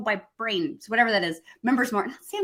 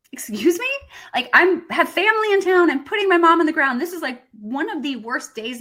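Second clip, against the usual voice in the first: louder and higher, incredulous retelling; indignant outburst; 7 semitones higher, 5 dB louder than usual. Retold or imitated. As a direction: relive the outrage at the client's nerve. Outraged retelling of client's request.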